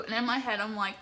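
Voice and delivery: usual speaking voice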